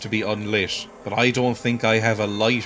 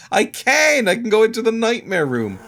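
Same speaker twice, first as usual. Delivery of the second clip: high and louder, thrilled; excited; 10 semitones higher, 3 dB louder than usual. Real, not acted. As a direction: burst out pleased at the discovery. Finds he can go back in.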